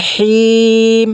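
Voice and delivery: "হিম" held long on a level note, then closed on m; chanted "হিম" stretched for a pause; three-count elongation. Shown as a demonstration. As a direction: draw out the ee of "হিম". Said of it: "তিন আলিফ টানিয়ে পড়িতে হয়".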